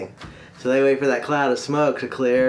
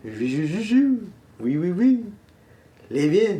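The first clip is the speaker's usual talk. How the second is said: put-on high, excited French accent; slow, halting French accent, groping for words; Frenchman's accent; 4 semitones higher, slower than usual. Frenchman makes his wish to the genie, excited French accent. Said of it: "and the Frenchman, he's first. He goes".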